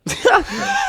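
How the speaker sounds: real laugh shooting up high; cracking up loudly. Real, not acted. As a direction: burst out in a loud, high laugh. Big laugh at dad's reply.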